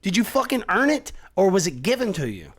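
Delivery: loud, high-pitched demand; rising challenge. Real, not acted. Ranting at people who want quick happiness, loud and high. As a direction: challenge them hard, pitch climbing.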